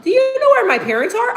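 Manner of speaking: put-on high, pleading kid voice asking a stranger; boy in a store asks where his parents are, high and whiny; lost little kid. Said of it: "he looked like".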